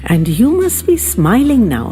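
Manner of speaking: plain reading voice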